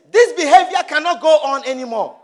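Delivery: acted angry declaration; angry protest. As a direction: rise up and put your foot down. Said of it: "rise up and be angry".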